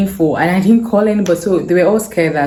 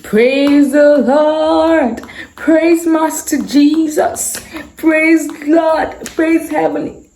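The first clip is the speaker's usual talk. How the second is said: mother praising God; 10 semitones higher, much slower than usual. Retold or imitated. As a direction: call out praise like a rejoicing mother. Mom rejoicing at daughter's return.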